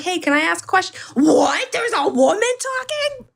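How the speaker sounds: high, whiny mock shock at a woman speaking; mock outraged man. Mocking a streamer who asked why a woman is talking, high and outraged. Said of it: "And then I'm like".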